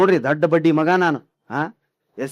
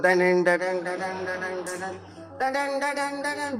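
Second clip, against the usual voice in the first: soft monotone "dyan dyan" sting, stepping up in pitch; mock dramatic TV sting on repeat; imitated suspense music; 6 dB quieter, faster than usual. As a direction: mimic a soap's mounting background score. Mimics serial's suspense music.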